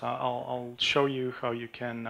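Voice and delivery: normal talking voice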